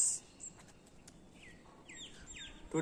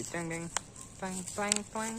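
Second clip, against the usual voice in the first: imitated twang; 5 semitones higher than usual. Mimicking the twang sound again.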